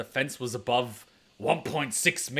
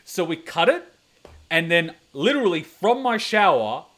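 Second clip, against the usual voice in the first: ordinary talk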